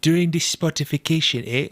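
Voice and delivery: puzzled imitation of a Jamaican-sounding accent; mock Jamaican accent. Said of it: "It almost sounds Jamaican".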